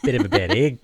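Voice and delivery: laughing attempt at a Kiwi accent; New Zealand accent. Shown as a demonstration. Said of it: "worked on my New Zealand accent".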